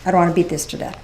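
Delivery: ordinary talk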